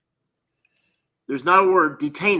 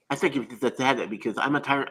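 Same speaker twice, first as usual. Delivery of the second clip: ordinary delivery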